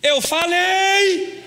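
loud, high, drawn-out "I told you!"; triumphant shout. Retold or imitated. Husband gloating to wife that God came through, loud.

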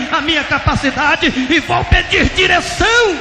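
crying out promises to God at full force; desperate repentant prayer. Retold or imitated.

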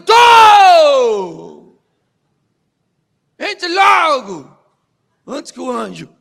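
loud high shout from below; shouting back. Acted. Daniel shouts up to the king from the lions' den, loud. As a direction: yell it up out of the pit.

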